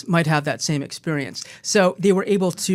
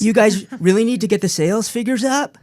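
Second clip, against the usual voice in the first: an order turned into a question, higher, rising on "up"; uptalk; 4 semitones higher than usual. Shown as a demonstration. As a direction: lift "sales figures up" like asking permission. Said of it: "the uptalk where everything's a question".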